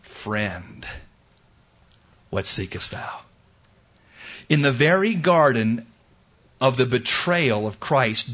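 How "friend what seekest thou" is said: soft, hushed question to a betrayer; gentle whisper. Retold or imitated. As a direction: breathe it tenderly, almost voiceless. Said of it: "the Savior said to Judas".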